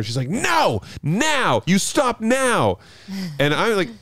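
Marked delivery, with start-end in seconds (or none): 0.3-2.8 high, drawn-out mock demand; imitated angry refusal. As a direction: imitate an indignant neighbour, each word stretched. Retelling the neighbour's refusal.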